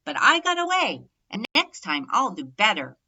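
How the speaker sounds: put-on young turkey after escaping the fox, loud relieved whoop; relieved triumph. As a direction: crow about getting away. Tom escapes after shaky landing.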